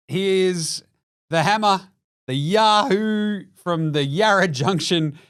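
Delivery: slow, drawn-out ring announcer build-up; booming boxing-style intro; hype intro. Acted. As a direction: announce him like a prize fighter. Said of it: "Oh, that was an intro".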